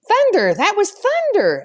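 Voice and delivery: high, loud relieved shout; children crying out in giggly relief. A narrator's reading. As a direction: burst out with relieved giggly excitement. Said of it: "in voices that were giggly with relief".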